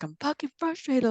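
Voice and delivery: high, strained tearful outburst; frustrated crying. Retold or imitated. Mother venting to daughter's dad through tears. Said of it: "like, crying".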